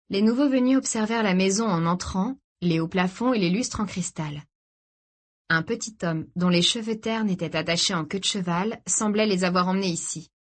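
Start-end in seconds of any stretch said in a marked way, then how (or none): none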